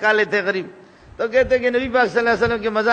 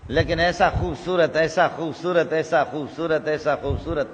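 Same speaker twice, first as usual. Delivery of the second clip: softer and softer with each repeat; fading awe; 6 semitones lower, 3 dB quieter than usual. Acted. Preacher marvelling at the Companion's beauty, fading to a hush. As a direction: let the awe sink to a near hush.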